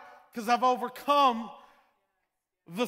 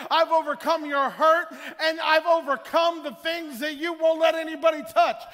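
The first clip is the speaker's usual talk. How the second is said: building, louder and higher preaching crescendo; preaching at full force; 3 semitones higher, 6 dB louder than usual. Acted. Preacher declaring victory to the congregation, building to a shout. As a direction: drive it home, climbing to a roar.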